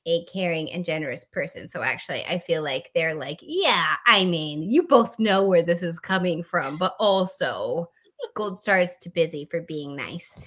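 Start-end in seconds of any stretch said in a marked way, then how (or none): 3.4-10.4 put-on Midwestern mom accent. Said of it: "go back into the Midwestern mom".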